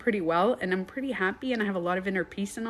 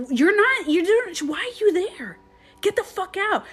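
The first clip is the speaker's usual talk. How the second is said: high-pitched, exasperated re-enacted yelling; retold screaming; 10 semitones higher, 5 dB louder than usual. Retelling her argument with sadness, high-pitched screaming. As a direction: push the pitch way up, scolding the feeling. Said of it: "I was screaming at my".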